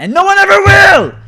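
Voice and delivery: sudden high yelling outburst. Real, not acted. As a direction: blow up and yell the last words. Ranting streamer yells at developers.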